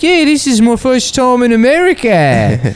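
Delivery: high, eager Australian guy; lightly voiced excited Aussie. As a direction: gush like a thrilled first-time traveler. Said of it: "He's, like, really excited".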